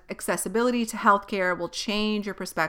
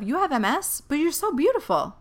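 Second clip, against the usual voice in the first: higher, sing-song fake compliment; people's clumsy remark, mimicked high and sweet; mocking comment; 8 semitones higher than usual. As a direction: parrot the awkward compliment. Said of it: "there's the comments of".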